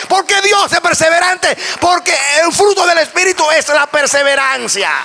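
high, loud shouted sermon; fiery preaching. Acted. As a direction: preach it at the top of your lungs to the congregation. Preacher rises to full force.

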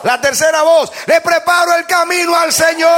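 high shouted declarations with held endings; proclamación a gritos. Real, not acted. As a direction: lead the crowd, stretching each last word. Leads congregation in shouted declarations. (proclamación aguda y sostenida a gritos)

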